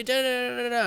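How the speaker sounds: hammed-up high blather; mock ranting. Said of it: "screaming at me".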